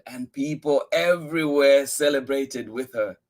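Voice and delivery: plain reading voice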